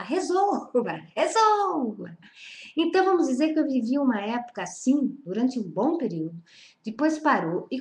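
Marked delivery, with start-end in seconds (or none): 0.0-2.2 higher-pitched demanding cry, drawn out at the end; imitated cry. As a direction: mimic someone crying out a demand. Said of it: "grita, fala".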